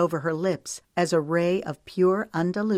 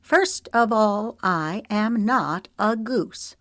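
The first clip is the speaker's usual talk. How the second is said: higher, louder, slow, every word spelled out; enunciating every word; 5 semitones higher, 3 dB louder, slower than usual. A narrator's reading. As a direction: bite off each word of "I am not a goose" separately. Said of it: "enunciating every word".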